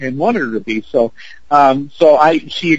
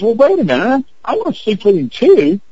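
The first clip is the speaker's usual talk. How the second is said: higher-pitched imitation of someone butting in; mock eager protest; 8 semitones higher than usual. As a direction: pipe up high, playfully demanding a turn. Bystander butts in, acted out.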